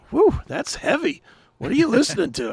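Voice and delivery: high-pitched imitation of an impressed doctor; taken-aback doctor. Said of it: "doctors looking at me and going".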